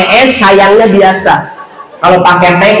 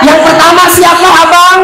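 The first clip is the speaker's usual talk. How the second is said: coy wife; 13 semitones higher than usual. Pretend. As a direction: tease your husband playfully. Wife flirtatiously asks husband.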